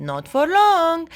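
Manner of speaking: high, stretched-out taunt; competitive retort. A narrator's reading. Brother races to overtake sister, taunting. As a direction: draw it out as a challenge.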